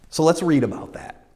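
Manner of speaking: normal talking voice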